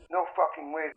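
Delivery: slightly shaken caller on the phone; refuse, a little thrown, down the telephone; a bit shaken. A narrator's reading. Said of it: "he sounded a bit shaken".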